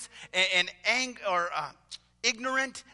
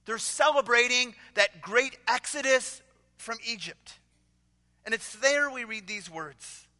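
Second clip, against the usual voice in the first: normal talking voice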